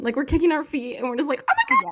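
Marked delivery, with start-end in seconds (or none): 1.5-1.9 high, loud fangirl squeal; excited scream. Retold or imitated. Readers reacting to a book scene with a high squeal. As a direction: shriek it like you're kicking your feet. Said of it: "we are kicking and screaming".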